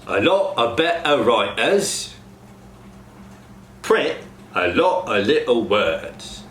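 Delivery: Cockney with T cut off in "better", "little"; Cockney glottal stops. Shown as a demonstration. Coach demos glottal stops to cast. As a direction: glottal stop example sentence. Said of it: "Example sentence".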